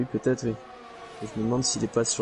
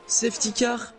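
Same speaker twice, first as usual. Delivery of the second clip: excited; 14 semitones higher, 5 dB louder than usual. Real, not acted. Sudden safety car call.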